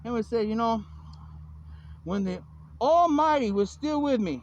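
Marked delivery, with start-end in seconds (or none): 2.0-4.4 solemn proclamation. Acted. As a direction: proclaim it grandly, stretching the words. Declaims a scripture-like quote.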